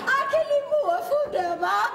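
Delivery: laughing. Acted. Queen laughs at the poem.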